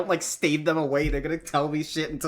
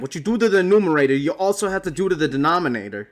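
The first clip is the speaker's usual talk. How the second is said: fast lecturing; 5 semitones higher, 6 dB louder, faster than usual. Retold or imitated. Mock teacher lesson.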